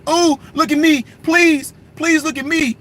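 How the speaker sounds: put-on high, pleading kid voice begging for attention; whiny little kid. Mocking a troll as an attention-seeking kid, high whiny plea. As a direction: beg like a needy little child. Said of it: "like a little bitty kid".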